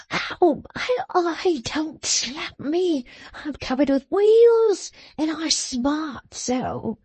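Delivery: high, wailing cries of pain, slowing down; pained crying out. Acted. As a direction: yelp and plead like a beaten man. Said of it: "to scream and to cry out".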